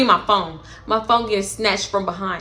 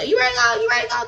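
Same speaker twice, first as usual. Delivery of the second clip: imitating the friends; 12 semitones higher, much faster than usual. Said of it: "I just heard them like".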